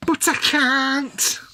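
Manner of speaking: milk "But I can't" in a long, high, theatrical wail; high, drawn-out mock lament. Shown as a demonstration. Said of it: "I wish I could do a proper sort of accent like that".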